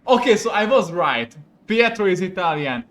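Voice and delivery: loud, high vindicated announcement; triumphant. Real, not acted. Player proved right about Pietro, loud triumph. Finds out they were right.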